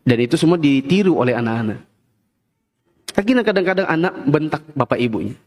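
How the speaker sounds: ordinary talk, nothing marked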